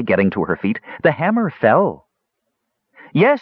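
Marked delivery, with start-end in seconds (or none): 1.0-2.1 high-pitched triumphant outcry; high female character voice, exultant; sudden cry. A narrator's reading. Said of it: "cried out suddenly". 3.1-3.4 high, triumphant cry; high-pitched cheer of victory; women's shout. A narrator's reading. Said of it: "shouted the women".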